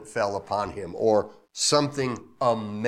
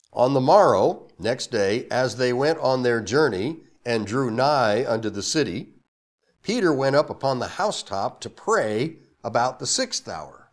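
a slightly louder, formal Bible reading; scripture reading; 5 dB louder than usual. Acted. Pastor reading the verse aloud to the church, formal. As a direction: read the King James verse out to the congregation.

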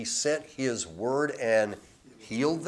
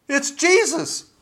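much higher, louder gasped cry; astonished recognition; 10 dB louder, slower than usual. Retold or imitated. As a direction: cry out as if seeing him suddenly.

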